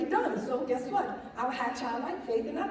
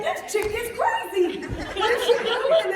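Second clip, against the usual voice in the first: laughing guests; 8 semitones higher, 6 dB louder, slower than usual. Retold or imitated. Guests laughing at running bride.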